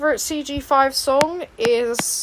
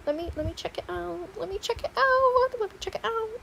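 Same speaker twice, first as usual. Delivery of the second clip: mock whiny voice; 7 semitones higher, 7 dB quieter than usual. Mocking repeated request, voiced whine.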